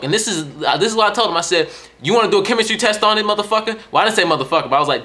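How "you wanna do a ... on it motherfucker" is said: higher, quick-fire retold challenge; mock mad challenge. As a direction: throw it out as a fast, cocky retort. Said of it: "I was getting mad".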